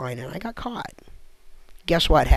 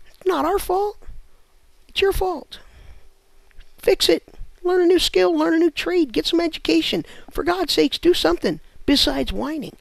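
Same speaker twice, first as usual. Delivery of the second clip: higher, rising rant; ranting lecture; 8 semitones higher than usual. Real, not acted. Comic scolds whiny audience members to fix it.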